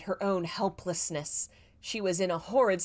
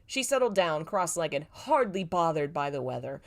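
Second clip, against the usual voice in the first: plain reading voice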